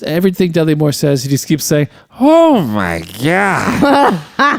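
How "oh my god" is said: a long, drawn-out, much higher oh my God; slow barfly drawl. Retold or imitated. Imitating the barfly who keeps repeating himself, slow higher drawl. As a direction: stretch every word like a haggard old barfly. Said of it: "he just talks like that".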